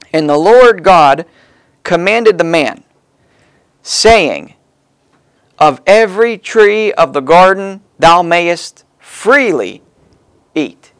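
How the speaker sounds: slow, weighty reading of the verse; scripture reading. Acted. Preacher reading scripture aloud to the congregation, slow and solemn.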